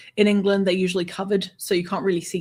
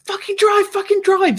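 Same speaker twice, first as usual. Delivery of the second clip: loud, high-pitched frantic yell; imitated screaming; 7 dB louder than usual. Passenger to driver on getting in the car, imitated screaming. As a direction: bark the order like a panicked passenger. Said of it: "he screams at me".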